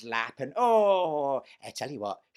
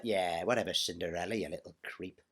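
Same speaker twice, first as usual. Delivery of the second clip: sneering; 7 semitones lower, 7 dB quieter than usual. Retold or imitated. Her own sneering reply.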